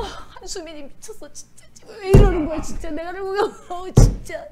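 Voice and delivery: mock sobbing. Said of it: "울어".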